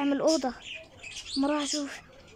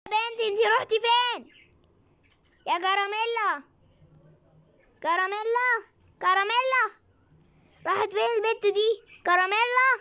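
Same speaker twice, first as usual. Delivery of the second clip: mom calling; 8 semitones higher than usual. Acted. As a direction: call out for the hiding girl. Mom voice searching for daughter. (أم بتنادي على بنتها بصوت عالي)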